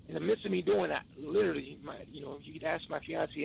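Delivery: ordinary talk, nothing marked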